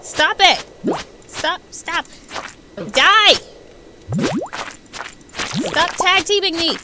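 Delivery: loud frantic shouts; yelling. Real, not acted. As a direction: yell at the monsters. Yelling at attacking monsters.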